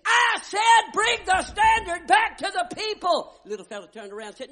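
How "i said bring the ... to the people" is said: very high, very loud, slow bellowed order; furious shout. Retold or imitated. Commander roaring his order a third time at the bearer. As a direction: bellow it across the battlefield.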